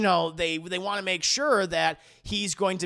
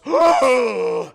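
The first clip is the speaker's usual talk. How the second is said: loud, higher scandalised gasp; loud mock gasp; 5 semitones higher, 11 dB louder than usual. Mocking the agnostic reveal, loud scandalised gasp.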